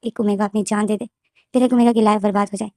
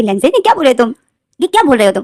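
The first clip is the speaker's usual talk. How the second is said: louder stern telling-off; scolding; 3 semitones higher, 7 dB louder, faster than usual. Acted. Mother scolding her son for rejecting the baby, stern and loud. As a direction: scold him firmly.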